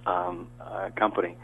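normal talking voice

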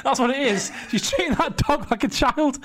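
hammed-up pub rant; ranting fan. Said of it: "And he's like".

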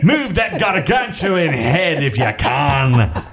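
slow, hammed-up Scottish bellow; broad mock Scottish accent, drawn out; Mike Myers Scottish accent. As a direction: play a bossy Scottish dad. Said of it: "how to do a Scottish accent is Mike Myers".

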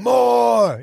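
high, loud drawn-out bellow; imitated scream. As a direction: bellow it like a raging villain. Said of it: "he's screaming".

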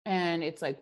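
ordinary delivery

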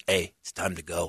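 low, quiet, dead-serious warning. Retold or imitated. Comic warning heckler, low and quietly serious. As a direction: drop it low and mean it. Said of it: "Like, I get real serious".

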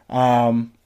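talking through a burp; a burp slips out mid-word. Real, not acted. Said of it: "There's that burp".

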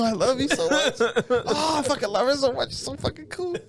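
high, laughing burst of adoration; giddy mock infatuation; mock gushing. Outrage flips to gushing love.

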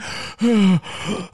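high, heaving mock gasps; acted gasping. Storyteller reliving the moment after vomiting, exaggerated gasps. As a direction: gasp as if just done retching.

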